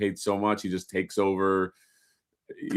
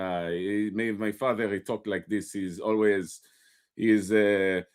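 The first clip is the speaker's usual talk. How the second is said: father's Arabic accent, lightly imitated; easygoing Middle Eastern accent. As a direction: talk like an Arabic-accented dad. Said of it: "He had a very Arabic accent".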